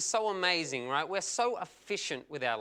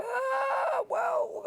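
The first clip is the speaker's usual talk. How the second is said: drawn-out high "Ah, well", far above usual pitch; stalling stage cry. Acted. Comic showing what he does when material is forgotten, stalling high. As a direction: stall with a long high wail. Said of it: "or you just go".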